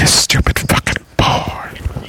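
slow teasing whisper. Real, not acted. Whispered insult, mic test.

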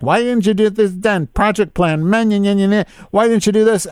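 high mocking voice of a nagging boss; high, mocking imitation of a scolding coworker. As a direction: whine it back like a nagging boss. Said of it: "this bitch is, like, reading me like".

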